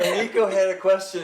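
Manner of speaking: laughing. Real, not acted. Host amused at the scripted opening, laughing. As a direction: laugh as you speak, amused at yourself.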